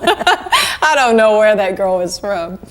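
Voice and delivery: laughing. Real, not acted. Laughing about her character.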